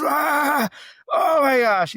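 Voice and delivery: high, loud whoop of wonder; retold thrill at full pitch; ecstatic cry. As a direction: shout out as if riding alongside dolphins. Retold thrill surfing with dolphins.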